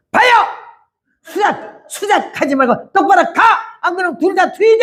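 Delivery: high, sharp rebuke; forceful yell. Retold or imitated. Evangelist yelling at the taxi driver to stop scheming, high and forceful. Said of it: "내 음성이 얼마나 강하게 나갔는지".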